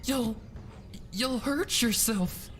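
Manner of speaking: high, slow, worried plea; worried pleading. A narrator's reading. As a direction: warn her anxiously. Fluttershy pleading.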